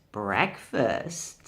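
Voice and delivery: low growling tiger snarl; deep tiger snarl. Acted. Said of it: "snarled the tiger".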